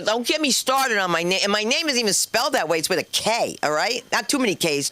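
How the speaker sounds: ordinary talk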